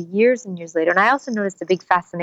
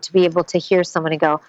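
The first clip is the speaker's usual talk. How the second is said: normal talking voice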